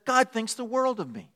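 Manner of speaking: high, softened, preening cat; lifted voice of a conceited cat; self-adoring cat. Acted. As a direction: say it high and pleased with yourself. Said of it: "A cat says".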